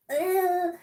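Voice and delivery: high, loud grossed-out ugh; disgusted ugh. Retold or imitated. To husband handing leaking battery, grossed-out ugh. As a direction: recoil at the battery goo. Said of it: "and I'm like".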